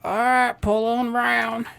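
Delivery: high, very slow, dazed drawl; mocking the cashier. Scene: the McDonald's cashier waving him forward, high and dragging. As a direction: stretch it out like a spaced-out cashier. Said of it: "the meth head that was taking my order".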